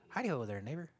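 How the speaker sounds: lightly voiced soft, folksy neighbor; low, quiet hint of a cartoon neighbor impression; Ned Flanders. Preacher showing what Jesus is not, soft neighborly impression. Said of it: "or Ned Flanders".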